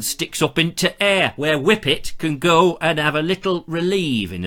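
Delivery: put-on northern English accent. Presenter reading out a viewer's reasons to go north, northern English accent. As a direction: play it as a chatty northern English lad's guide, accent and all. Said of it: "gone into a northern accent".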